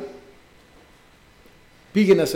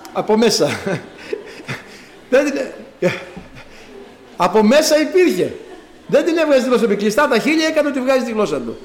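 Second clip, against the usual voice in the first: higher and louder, laughing through the words; 6 semitones higher, 3 dB louder than usual. Real, not acted. Preacher to the congregation, recalling his son's trick, laughing. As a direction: chuckle as you explain the trick.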